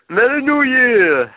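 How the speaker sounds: high, slow, loud greeting; cheery shout. Real, not acted. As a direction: call it out festively.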